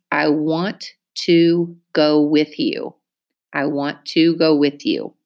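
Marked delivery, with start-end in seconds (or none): 0.1-2.9 slow, even, word by word; slow dictation. Shown as a demonstration. Teacher dictating to class, slow and careful. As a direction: read I want to go with you slowly, each word on its own. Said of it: "Listen to me use it in a sentence".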